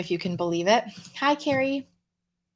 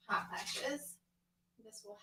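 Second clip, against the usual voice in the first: whisper; 18 dB quieter, slower than usual. Real, not acted. Aside about hot flashes.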